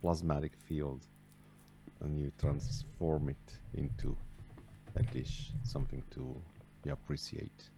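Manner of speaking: faint, distant voice far from the mic; barely audible. Real, not acted. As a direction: speak very softly, off-mic. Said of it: "your voice is very faint".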